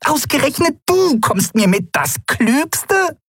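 very high, slow, indignant emphasis; high, incensed little voice leaning on DU; furious disbelief. A narrator's reading. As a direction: stretch the accusation out in high-pitched rage. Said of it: "Kai spürte die Wut".

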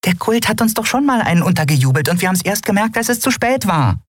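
very high, racing little creature voice; squeaky, hurried alarm; agitated. Acted. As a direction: rattle off the worry in a tiny high voice. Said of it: "Nelen flatterte aufgeregt hin und her".

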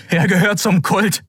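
high, urgent blurt. A narrator's reading. Kai, terrified, exposes Keru to the others, blurting. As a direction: force the words out in alarm. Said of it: "stieß Kai hervor".

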